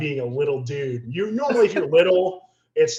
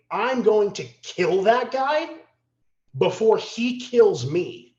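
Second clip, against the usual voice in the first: grim resolve; 9 semitones higher, 7 dB louder, slower than usual. Retold or imitated. Inner thought in danger.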